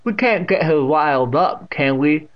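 put-on deaf old aunt, stern. A narrator's reading. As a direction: chide loudly.

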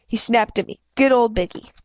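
plain reading voice

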